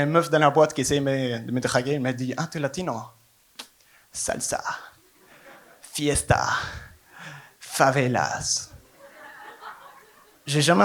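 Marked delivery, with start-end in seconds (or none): 2.4-8.7 soft, drawn-out list of clichés; clichéd flirt. Retold or imitated.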